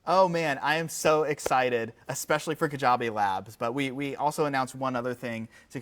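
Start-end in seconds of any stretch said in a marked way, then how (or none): none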